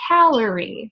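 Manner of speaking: calorie starting high and falling; sing-song calorie, up then down; sing-song stress. Shown as a demonstration. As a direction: hit CAL high, let the rest of calorie drop. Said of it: "it starts high and it gets lower".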